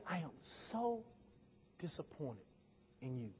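very soft, low and halting; hushed disappointment. Retold or imitated. Preacher repeating mama's words, hushed disappointed rebuke. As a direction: drop almost to nothing, pausing between phrases.

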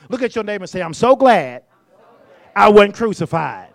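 loud, driving preacher's call, rushing in places; full-force preaching. Acted. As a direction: preach it hard to the room.

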